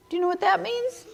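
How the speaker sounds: ordinary delivery, nothing marked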